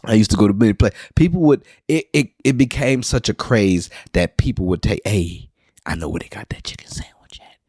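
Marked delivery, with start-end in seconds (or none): 5.1-7.6 hushed whisper, passing on a secret tip. Retold or imitated. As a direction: whisper it like insider info about where to find the sandwich. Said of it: "you got the whispers".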